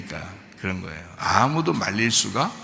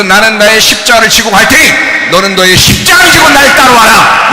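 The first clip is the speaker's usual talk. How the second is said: fiery preaching; 8 semitones higher, 15 dB louder than usual. Real, not acted. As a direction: thunder it from the pulpit. Preaching at full force.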